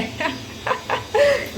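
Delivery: high-pitched amused laugh; high giggle. Real, not acted. Vlogger jokes about her appetite, high giggle.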